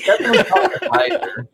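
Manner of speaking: laughing through the words; high-pitched laugh while speaking. Real, not acted. Laughing while describing replay.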